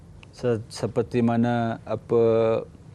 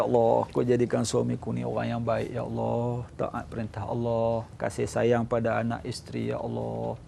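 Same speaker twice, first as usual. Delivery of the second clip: soft, low prayer fading almost to nothing; murmured prayer; 3 semitones lower, 6 dB quieter than usual. Shown as a demonstration. Wife on the motorbike prays softly behind husband, fading murmur. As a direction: pray under your breath. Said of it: "kita duduk belakang dia".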